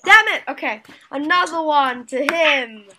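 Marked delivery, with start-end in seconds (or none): 0.0-0.4 loud, high burst of frustration; frustrated shout. Real, not acted. Player loses another point to him, shouting. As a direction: curse loudly at a lost point.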